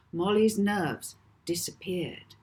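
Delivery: plain reading voice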